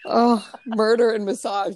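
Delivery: ordinary delivery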